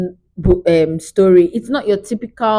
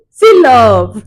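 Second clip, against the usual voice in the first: exclamation; 3 semitones higher, 7 dB louder than usual. Real, not acted. Exclaims over the love story.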